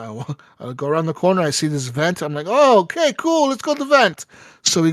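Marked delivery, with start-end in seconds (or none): 2.5-4.3 high, sing-song delighted okay; bright, high, hammed-up eagerness; mock cheerful. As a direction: act giddy about the vent. Finding the vent, mock cheerful.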